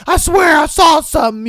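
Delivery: high, loud scared insistence; spooked kid. Retold or imitated. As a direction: swear it like a kid who saw a ghost. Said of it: "that one fearless kid that is just like".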